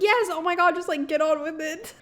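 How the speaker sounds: high exasperated voice; impatient. Retold or imitated. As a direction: hurry them along, fed up. Impatient heroine quote.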